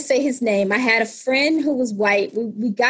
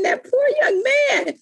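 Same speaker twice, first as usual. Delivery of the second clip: mother's pity, higher, slow and crying out; mother's lament; 12 semitones higher, slower than usual. Retold or imitated. Mother repeating her pity, slower, with a crying shout. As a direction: stretch it out like a pained lament. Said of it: "she kept saying".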